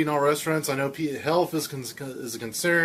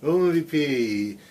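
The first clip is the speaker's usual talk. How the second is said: announcer tag; slower than usual. Acted. Jingle tag.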